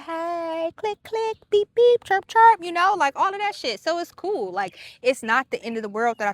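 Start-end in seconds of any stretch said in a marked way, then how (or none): 0.0-2.6 high, playful imitated clicks and beeps; bouncy toy-robot sound effects; mock gadget noises. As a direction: chirp the beeps like a gadget coming alive. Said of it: "I'ma be like".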